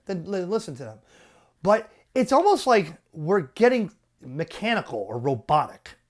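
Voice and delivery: usual speaking voice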